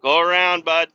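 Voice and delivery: loud call to the car behind. Real, not acted. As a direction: tell the trooper to pass, loudly. Talking to trooper behind.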